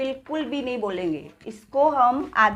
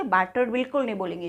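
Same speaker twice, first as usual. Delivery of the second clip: ordinary delivery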